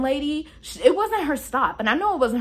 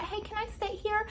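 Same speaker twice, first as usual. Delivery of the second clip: high, soft lady voice; high timid ask from the lady; softly voiced lady asking to sit; 9 semitones higher, 9 dB quieter than usual.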